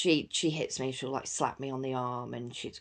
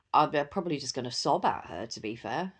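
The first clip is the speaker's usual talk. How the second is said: ordinary talk, nothing marked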